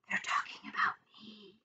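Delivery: hushed, voiceless inner thought; very soft, breath-only whisper; frightened whisper. A narrator's reading. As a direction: whisper it to yourself in dread. Boy's frightened secret thought.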